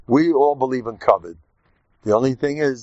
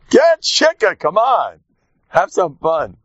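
excited; 6 semitones higher, 4 dB louder, slower than usual. Real, not acted. Urging listeners to get drunk.

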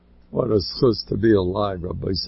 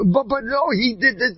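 whiny complainer; 12 semitones higher than usual. Retold or imitated. Mock quarrel over being covered.

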